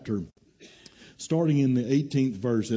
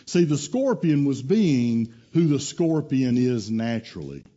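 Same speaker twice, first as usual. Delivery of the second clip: ordinary delivery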